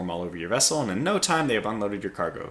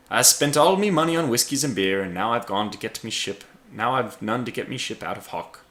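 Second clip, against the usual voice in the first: Irish brogue; 2 semitones higher, 3 dB louder than usual. Acted. Drunk Irishman laments lost money.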